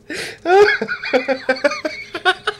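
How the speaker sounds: high, helpless laughing fit; real wheezy giggle fit; uncontrollable laughter. Real, not acted. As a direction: burst out laughing again and again. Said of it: "I can't stop laughing".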